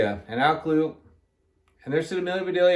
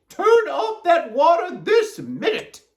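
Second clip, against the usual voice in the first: high, loud, slow command; shouted order; 13 semitones higher, 5 dB louder, slower than usual. A narrator's reading. Miss Rogers orders the hose off, shouting. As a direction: shout the order, stressing it word by word. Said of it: "shouted Miss Rogers".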